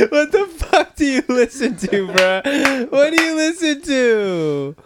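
high, loud laughing question; laughing in disbelief. Real, not acted. Laughing at the song Siri played.

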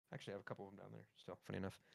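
talking between sips; mumbling around a drink. Real, not acted. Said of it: "sorry, I'm drinking".

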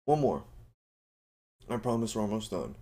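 ordinary talk, nothing marked

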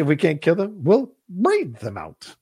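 high, slow, haughty king; pompous king voice. Retold or imitated. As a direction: deliver it like a dismissive tyrant. Quoting Longshanks' scene.

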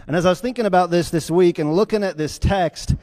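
normal talking voice

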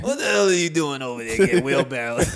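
imitated exasperated Italian father; mock-outraged Italian dad, amused underneath; older Italian dad. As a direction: scold your son as an older Italian guy. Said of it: "My dad's an older Italian guy".

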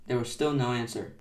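ordinary delivery

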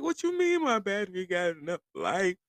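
high-pitched question through laughter; laughing disbelief. Retold or imitated. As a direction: retell your disbelief, cracking up. Retold disbelief at mechanic, laughing.

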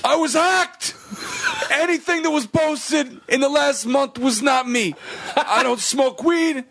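high, slow mock-angry [name]; hammed-up [name] rant; [name] impression. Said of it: "you have to read it as [name]".

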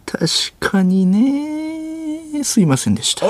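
high, long-drawn concession dropping into apology; 粗品任三郎. Acted. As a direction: stretch it out like a defeated parody sleuth. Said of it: "粗品任三郎さん。". (刑事のものまねで、伸ばしてから折れる)

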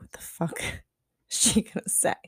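laughing through a hushed whisper; whispering the thought she had, half laughing; inner panic whisper. Retold or imitated. Whispered aside while the teacher sits them down. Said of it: "I was like".

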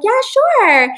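imitated perky drive-thru worker; mock chipper employee. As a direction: chirp it like an eager order-taker.

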